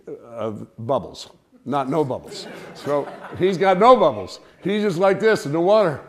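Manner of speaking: ordinary delivery, nothing marked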